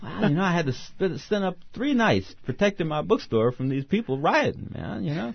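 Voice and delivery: high-pitched comic character voice; Paris. Retold or imitated. Paris telling Easy about the riots, comic character voice.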